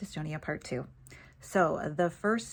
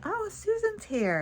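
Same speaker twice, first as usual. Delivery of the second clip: surprised recognition; slower than usual. Retold or imitated. Recognizing Susan's voice nearby.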